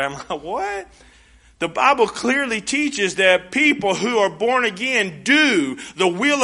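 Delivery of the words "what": incredulous what. Retold or imitated. Reacting to works objection.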